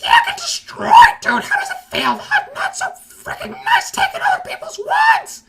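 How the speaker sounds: put-on squeaky, very high kid gamer taunting; Harry Potter. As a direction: shriek a gloating trash-talk in a tiny voice. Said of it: "He's just in the chat like".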